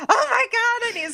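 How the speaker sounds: high, frantic mock panic; retold panic. Reliving chasing her journals around the yard, in high mock panic. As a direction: squeal it like the journals are flying off. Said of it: "So I'm like".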